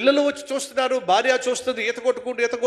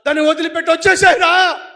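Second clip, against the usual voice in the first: loud plea to come back; urging shout; 7 semitones higher, 8 dB louder than usual. Acted. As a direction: yell at him to let go. Villagers urging him back. (గట్టిగా బతిమాలే అరుపు)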